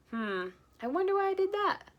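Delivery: high, wondering voice; muse, baffled; puzzled. Acted. Husband scratching his head, puzzled. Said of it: "said Mister Forgetful".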